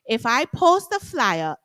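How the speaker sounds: ordinary talk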